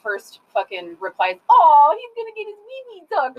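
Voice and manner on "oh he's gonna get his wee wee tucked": much higher, louder mocking girl voice; teasing daughter. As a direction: mimic a bratty teen teasing her brother.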